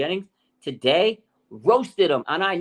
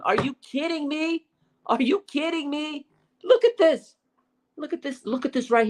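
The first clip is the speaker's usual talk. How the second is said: high, incredulous cries of disbelief; can't believe the play he's showing; excited disbelief; 12 semitones higher than usual. Real, not acted. Showing viewers the picture, excited and disbelieving.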